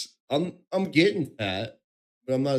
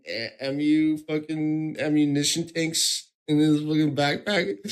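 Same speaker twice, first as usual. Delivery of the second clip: normal talking voice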